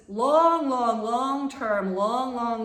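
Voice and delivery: LONG repeated, each one stretched out loud; loud, slow, elongated LONG, LONG, LONG; drawn-out emphasis. Real, not acted. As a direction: stretch every "long" for playful exaggeration. Drawing out a long distance.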